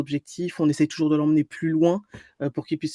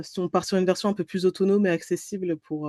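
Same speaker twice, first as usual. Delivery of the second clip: ordinary talk, nothing marked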